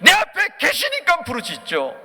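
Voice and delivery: high, loud, drawn-out cry; slow, emphatic sermon shout; preacher's shout. Real, not acted. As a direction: proclaim it at full voice. Preacher shouts his answer.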